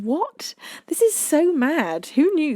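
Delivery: high, drawn-out disbelief; shocked. Real, not acted. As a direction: gasp at the absurdity. Shocked at snail fact.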